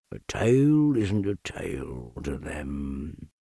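low, slow, mournful donkey drone; deep, dragging, defeated donkey voice; gloomy Eeyore. Acted. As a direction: sigh each word out like a sad old donkey.

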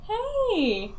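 calling out high through a shut door. Acted. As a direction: call to someone behind a door. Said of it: "I'm gonna be like".

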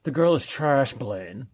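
lightly voiced smug rich kid; a hint of a sneering lockjaw drawl; James Spader impression. Host mimics James Spader's snooty rich kid. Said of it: "in my best, uh, James Spader voice".

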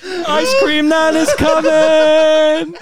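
high, slow, laughing yell; laughing granny cry. Retold or imitated. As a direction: shout it out slowly through laughter. Laughing nan impression.

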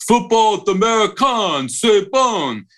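hammed-up Brooklyn accent on the French words, like a football commentator. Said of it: "He's speaking French with a Brooklyn accent".

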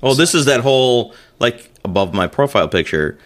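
ordinary talk

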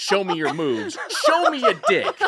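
Captain Falcon taunt cadence, laughing. Retold or imitated. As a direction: mimic a fighting-game taunt. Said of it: "cadence of Captain Falcon's taunt".

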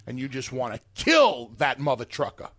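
normal talking voice

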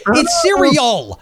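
"cereal" high and stretched, ending on L; correcting, no S. Retold or imitated. Correcting the family's plural, exasperated drawn-out "cereal". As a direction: slowly insist on "cereal" without an S. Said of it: "I'm like".

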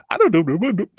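mocking dopey-voice imitation; stupid voice. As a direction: mimic someone's silly voice in mockery. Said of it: "that little stupid, stupid voice".

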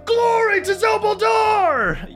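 high, slow rallying shout; rally the troops, full voice; battle cry. Acted.

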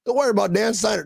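high, mocking sing-song voice; high voice, mocking the league, fast at the end; mock NFL voice. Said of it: "N F L's like".

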